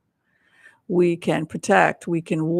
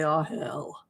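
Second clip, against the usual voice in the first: resigned groan; 2 semitones lower, 6 dB quieter than usual. Retold or imitated. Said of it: "And then they say".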